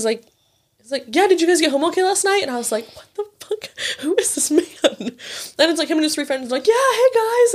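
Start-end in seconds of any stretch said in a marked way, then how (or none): 1.1-2.4 quick, high, chummy question; cheery guy. Retold or imitated. Stranger cheerfully checking on her, quick and high. Guy acts like an old friend. 3.0-4.6 high, incredulous what the fuck; baffled disbelief. Retold or imitated. She reacts to a stranger greeting her, high and baffled. She doesn't recognize the man. 6.7-7.5 high, bright mock greeting; cheery friends. His friends greeting her cheerfully, much higher.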